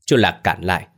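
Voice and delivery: ordinary delivery, nothing marked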